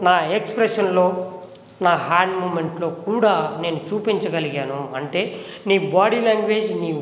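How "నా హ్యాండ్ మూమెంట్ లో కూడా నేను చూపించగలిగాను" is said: ordinary talk, nothing marked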